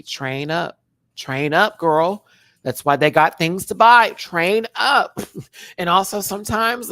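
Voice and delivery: loud, rallying hype for the bottoms. Real, not acted. Hyping bottoms to train up, loud and rallying. Urging listeners loudly.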